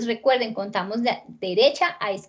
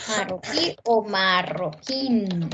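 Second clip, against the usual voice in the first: "Marroquí o marroquín" slow, each last syllable held; stress on final syllables; 4 semitones lower, slower than usual. Shown as a demonstration. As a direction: stretch the endings of "Marroquí o marroquín". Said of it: "Tienen el acento en la última sílaba". ("Marroquí o marroquín" lento, marcando las sílabas finales)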